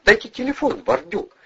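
high-pitched, impatient demand; impatient woman repeating her demand. A narrator's reading. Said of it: "нетерпеливо повторила женщина".

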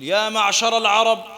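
high, loud, ringing hadith recital; preaching at full force. Acted. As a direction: proclaim it like a sermon peak.

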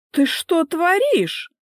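high, loud, drawn-out indignant demand; indignant wife. Acted. Wife confronts husband over what he did, high indignant voice. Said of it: "впилась в него гневным взглядом".

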